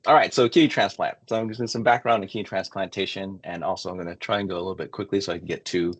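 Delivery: ordinary talk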